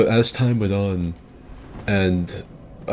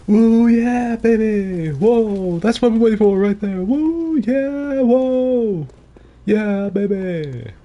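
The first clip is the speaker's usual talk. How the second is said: high, loud whooping hype yells; hype streamer impression; 14 semitones higher, 5 dB louder, much slower than usual. Imitating Big Moist's hype shout for stream chat, high and loud. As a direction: whoop it up like an over-excited streamer. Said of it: "the fucking thing that my older brother does".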